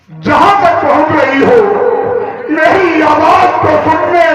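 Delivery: full-force preaching. Acted. Preacher calling to listeners across the earth, full-force preaching. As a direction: preach it out to every listener on earth, high and carrying. Addresses all brothers on earth.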